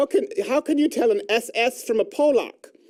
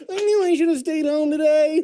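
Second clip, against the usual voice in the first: mock lament; 7 semitones higher than usual. Comic complaint in put-on voice.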